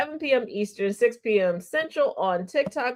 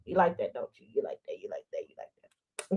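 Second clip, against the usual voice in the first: low, soft, fading to unvoiced; teasing murmur; 12 semitones lower, 10 dB quieter, faster than usual. Host teasing the audience in a low, soft murmur.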